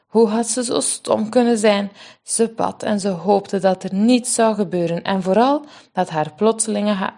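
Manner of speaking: plain reading voice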